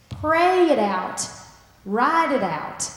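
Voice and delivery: slow, loud, each phrase punched; urging the congregation, emphatic; emphatic exhortation. Real, not acted. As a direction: preach it out slowly. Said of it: "So I invite you to".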